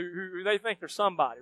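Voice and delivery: ordinary talk, nothing marked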